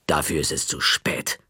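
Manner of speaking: low, cold librarian verdict; stern librarian. Acted. The librarian tells the boy his punishment, low and cold. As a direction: deliver the sentence, low and final.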